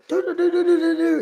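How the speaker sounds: sung high "doo doo" like a chime; doorbell imitation. Woken early by the doorbell, high sung chime.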